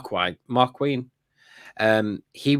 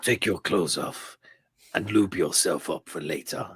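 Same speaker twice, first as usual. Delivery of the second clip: low, sly mock voice; 8 semitones lower, 9 dB quieter than usual. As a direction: purr it like a creepy crime boss. Mock Boba order, low and soft.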